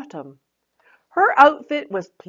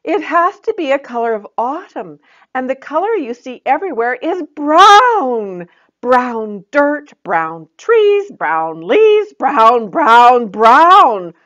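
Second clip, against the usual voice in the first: higher, louder, growing sick of brown; disgusted; 8 semitones higher, 11 dB louder than usual. Acted. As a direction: spit out each brown more loudly. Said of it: "Autumn said in disgust".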